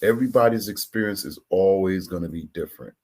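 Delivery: normal talking voice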